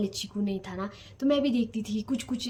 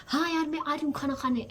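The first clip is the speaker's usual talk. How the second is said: higher-pitched mock irritation; irritated retort; 6 semitones higher than usual. Daughter tensely answering a call to eat, irritated. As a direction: re-enact an annoyed reply, voice raised in pitch. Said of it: "मेरे को गुस्सा आ रहा होगा".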